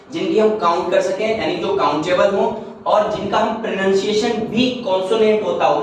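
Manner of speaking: ordinary talk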